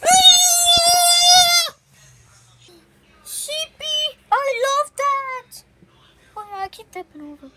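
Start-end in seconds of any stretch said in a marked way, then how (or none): 0.0-1.7 sudden high-pitched loud squeal. Pretend. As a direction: squeal out in surprise. Toy play, sudden cry.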